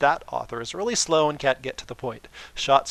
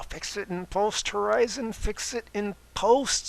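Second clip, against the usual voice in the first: drawled catchphrase; 8 semitones higher, slower than usual. Acted. Some character's catchphrase.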